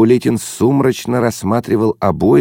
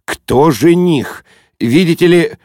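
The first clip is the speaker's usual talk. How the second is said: slow, measured, rising indignation; cold challenge; 6 semitones higher, slower than usual. A narrator's reading. Offended father challenging the guest, coldly indignant. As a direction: question each word with cold offence. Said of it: "холодно произнёс Улитин".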